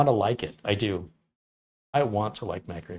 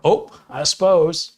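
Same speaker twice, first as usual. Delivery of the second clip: put-on high, loud, drawn-out Midwest accent; slow mock Upper Midwest sigh-off; Midwest goodbye; 5 semitones higher, 7 dB louder, slower than usual. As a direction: stretch it out as a cue to leave. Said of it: "that's how you exit".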